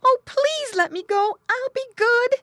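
very high, loud piglet pleading; squealing little pig. A narrator's reading. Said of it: "Squinty squealing in his pig talk".

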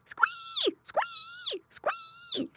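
very high pig squeals; squealing piglet. A narrator's reading. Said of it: "yelled Squinty".

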